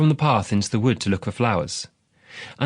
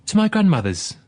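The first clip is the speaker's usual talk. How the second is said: put-on higher, innocent little girl; girl's voice; 9 semitones higher than usual. Redcap telling the wolf where she goes, higher girlish voice. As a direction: say it brightly like a small child.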